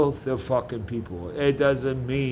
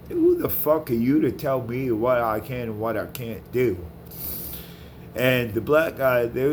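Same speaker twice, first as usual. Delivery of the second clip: ordinary talk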